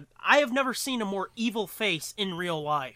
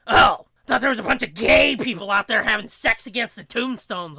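mock cousin; 3 semitones higher, 9 dB louder than usual. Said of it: "And she goes".

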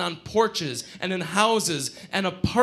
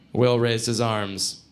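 ordinary delivery, nothing marked

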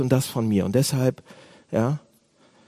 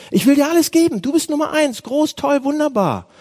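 much higher, hammed-up enthusiasm; gushing mock praise; 10 semitones higher, 3 dB louder than usual. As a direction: heap exaggerated praise on God in prayer. Acted gushing praise to God. (übertrieben begeistertes, hohes Lobpreisen)

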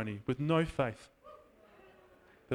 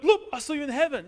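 friend's excited report; 10 semitones higher, 6 dB louder than usual. Retold or imitated. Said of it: "And then my friend went".

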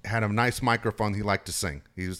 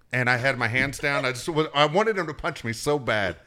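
normal talking voice

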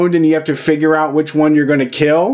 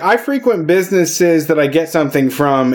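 usual speaking voice

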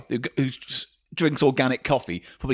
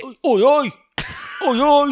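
high, loud mock catcall; leering lad; 12 semitones higher, 4 dB louder than usual. Mocking men who buy these gifts, high laddish catcall. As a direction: yelp it out like a rowdy lad. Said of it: "Fucking hate men like that".

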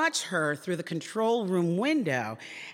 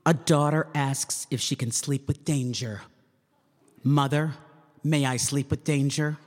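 promo voiceover read; 3 semitones lower than usual. Acted. Voice actor reading the network promo in booth, dramatic announcer voice. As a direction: sell the TV movie like a dramatic promo. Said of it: "Another script".

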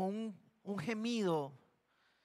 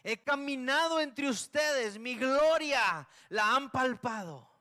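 higher, louder and slow, thundering rebuke with "caminado" stretched out; God raising his voice in slow judgment; 4 semitones higher, 5 dB louder, slower than usual. Acted. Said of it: "nos dijo el Señor".

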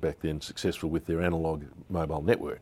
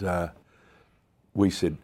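ordinary talk, nothing marked

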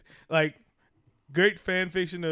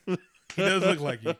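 words squeezed out through laughter, higher and louder; laughing; 5 semitones higher, 7 dB louder than usual. Real, not acted. Teasing his co-host about the baby photo, laughing. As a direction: crack up while saying it.

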